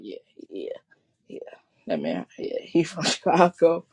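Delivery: imitating folks nodding along, low and knowing; mock knowing voice. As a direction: play the guy who knew it all along. Mimicking people confirming his hometown.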